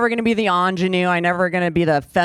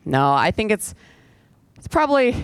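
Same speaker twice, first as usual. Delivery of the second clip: ordinary delivery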